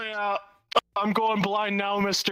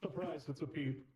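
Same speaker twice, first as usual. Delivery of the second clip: through a wall; 4 semitones lower, 14 dB quieter than usual. Real, not acted. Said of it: "audio setting to make it sound like it's muffled".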